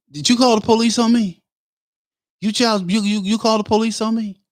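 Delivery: high-pitched mock accusing voice; imitated confronting man. Acts out man confronting him.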